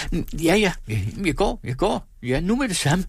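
high, placating protest; warding off. A narrator's reading. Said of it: "vinkede afværgende".

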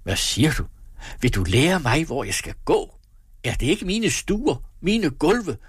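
blustering, climbing to high pitch; affronted, escalating questions; indignant outrage. A narrator's reading. Master indignantly answers the maid.

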